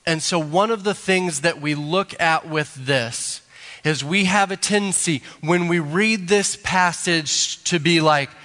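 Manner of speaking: usual speaking voice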